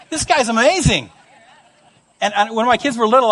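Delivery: ordinary delivery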